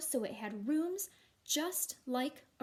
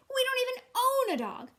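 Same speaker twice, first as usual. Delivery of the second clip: high loud disbelieving dad; dad can't believe it; exasperated dad; 11 semitones higher, 9 dB louder than usual. Acted. Dad answering Matthew, loud and exasperated.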